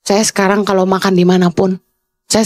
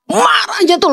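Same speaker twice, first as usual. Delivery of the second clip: far higher, drawn-out opening; angry high pitch; 13 semitones higher than usual. Shown as a demonstration. Preacher describing her anger at staff, shrieky high pitch. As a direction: jump way up in pitch, stretching the first word. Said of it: "dua oktav ke atas gitu".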